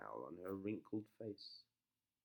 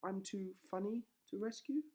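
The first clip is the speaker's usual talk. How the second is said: Rapunzel; 10 semitones higher than usual. Acted. Rapunzel in the tower.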